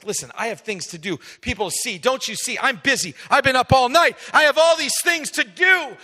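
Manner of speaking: climbing to a high, loud shout; rising from irritated to yelling; frantic outburst. Retold or imitated. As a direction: Peter snapping, busy and overwhelmed. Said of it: "He's like".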